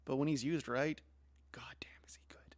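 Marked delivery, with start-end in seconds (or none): 1.5-2.6 whisper. Real, not acted. Whispered praise, no voice.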